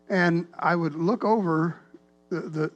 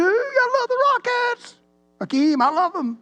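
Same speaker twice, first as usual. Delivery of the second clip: excited fan cheer; 4 dB louder than usual. Retold or imitated. Acting out his cheering as a fan.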